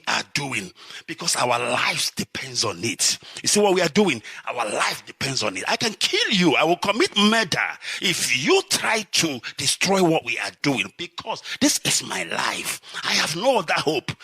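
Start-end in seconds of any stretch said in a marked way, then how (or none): none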